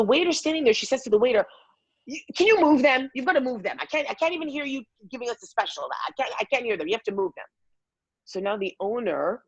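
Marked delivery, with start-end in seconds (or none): 2.1-7.5 imitated agitated complaining, pitch raised; indignant complaint. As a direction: play a fussy diner demanding action. Acted complaint to the waiter.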